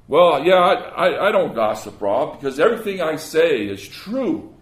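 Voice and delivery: loud, indignant mock self-justification; defensive gossiper. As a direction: protest loudly, acting the gossip who denies it. Acting out a gossiper's defensive retort.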